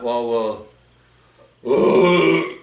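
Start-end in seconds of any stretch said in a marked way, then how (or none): 1.7-2.6 sudden loud burp; loud belch. Real, not acted. Vlogger burps mid-sentence about clothing shopping, loud belch. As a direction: let out a big burp. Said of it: "excuse me, pardon me".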